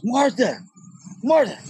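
high, drawn-out call of Martha; mock yell for his wife. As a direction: call across the room for Martha. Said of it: "He goes".

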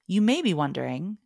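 ordinary talk, nothing marked

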